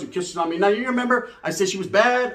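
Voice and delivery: usual speaking voice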